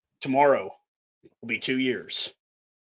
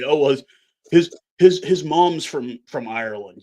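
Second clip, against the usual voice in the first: mocking pundit excuse; 2 semitones higher, 7 dB louder than usual. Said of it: "Don't get up there and say".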